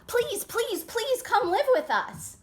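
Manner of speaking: high, earnest begging; pleading. Acted. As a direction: beg him to come live with them. Jitterbug pleads with Pudding.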